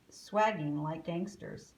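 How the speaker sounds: plain reading voice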